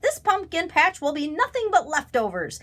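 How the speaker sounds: high, gloating taunt; mocking. A narrator's reading. Crow boasting about the feast, high mocking. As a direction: gloat at him, high-pitched. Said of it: "mocked Bernie".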